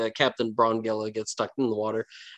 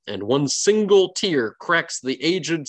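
ordinary talk, nothing marked

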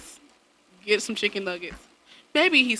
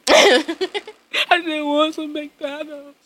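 put-on slow, drawn-out sulky kid whine; son's whine; 6 semitones higher, much slower than usual. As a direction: son complaining from his room. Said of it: "I hear him in the room like".

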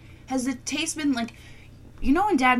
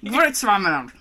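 German accent; 8 dB louder, faster than usual. Shown as a demonstration. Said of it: "When you say it in a German accent, it works better".

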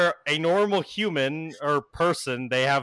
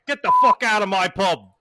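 loud, raised British yell; angry British bartender; 5 semitones higher, 8 dB louder than usual. Acted. Bartender ordering customers out of his pub, angry British yell. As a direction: throw them out in a British accent. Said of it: "he's like".

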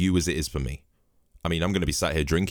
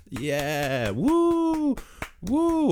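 mock cheering; much slower than usual. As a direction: imitate forced cheering way up high. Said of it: "we stood on our doorsteps every Thursday night going".

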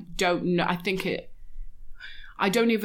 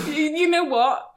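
laughing; 11 semitones higher, 5 dB louder than usual. Real, not acted. Laughing at the dream reading.

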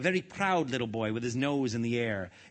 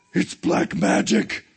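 high, loud, slow horrified cry; shriek; 6 semitones higher, 8 dB louder, much slower than usual. A narrator's reading. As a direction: shriek it in drawn-out terror. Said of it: "he shrieked".

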